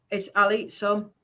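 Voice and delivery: ordinary talk, nothing marked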